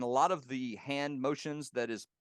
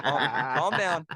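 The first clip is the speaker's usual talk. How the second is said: laughing; 5 semitones higher, 10 dB louder, slower than usual. Retold or imitated. Telling himself to stay calm.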